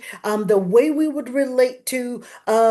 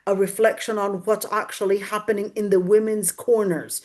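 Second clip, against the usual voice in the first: normal talking voice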